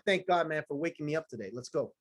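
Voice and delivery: hushed, grateful prayer to God; soft, low-key gratitude on waking; quiet morning prayer. Retold or imitated. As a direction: murmur it like a private thank-you. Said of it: "I'm like".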